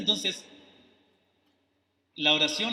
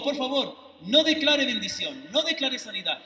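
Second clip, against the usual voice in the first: usual speaking voice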